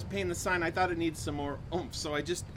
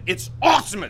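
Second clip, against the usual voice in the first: monster truck ad; 3 semitones higher, 7 dB louder than usual. Acted. Bill keeps doing the voice.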